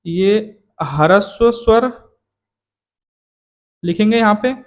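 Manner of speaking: ordinary talk, nothing marked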